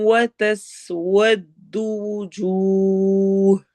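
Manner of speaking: slow, measured recitation; Quranic recitation. Shown as a demonstration. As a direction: recite each syllable with care. Teacher recites Quranic verse.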